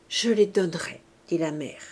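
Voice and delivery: ordinary delivery, nothing marked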